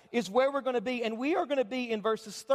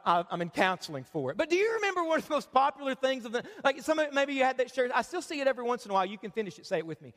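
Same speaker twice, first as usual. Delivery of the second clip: ordinary talk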